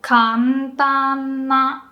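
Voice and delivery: Kantan na with ka low, rest high; slowly: ka low, ntan na high; slow pitch demo. Shown as a demonstration. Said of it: "Low, high, high, high, high".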